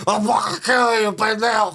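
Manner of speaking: slurred yelled threat; mock drunken rage. Drunk waking up in a rage at a stranger, yelling. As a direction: roar it like a raging drunk.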